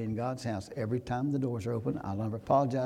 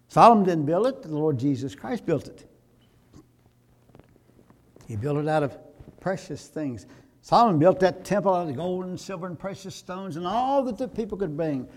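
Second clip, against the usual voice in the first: louder and higher, pressing the point home; preaching at full force; 5 semitones higher, 6 dB louder than usual. Acted. Pastor to congregation about who built the church, fervent preaching. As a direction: drive the contrast hard from the pulpit.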